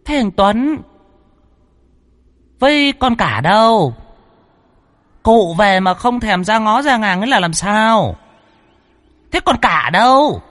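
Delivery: put-on very high, piercing scolding old ancestor; shrill possessed voice. A narrator's reading. Ancestral spirit demanding the eldest daughter-in-law, shrill and high. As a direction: scold shrilly, far above your own voice. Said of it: "lại cất tiếng lanh lảnh".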